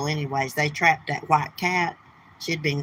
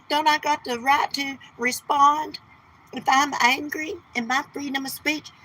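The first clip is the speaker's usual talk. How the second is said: high, whiny mock of Missus Portland; 8 semitones higher than usual. Streamer mocks Missus Portland's complaint in a high whine. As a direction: whine it like a sulky defendant. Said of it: "She's like".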